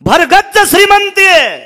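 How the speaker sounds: high ringing shout on श्रीमंती; loud sermon cry; preaching shout. Acted. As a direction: cry out the refrain to the crowd. Preacher's refrain repeated.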